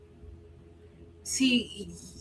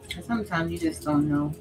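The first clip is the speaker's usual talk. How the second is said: usual speaking voice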